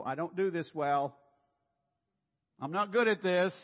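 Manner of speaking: a worried person facing a task they dread, loud and high; anxious. Acted. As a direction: blurt it out like fear is rising. Voicing anxious self-talk.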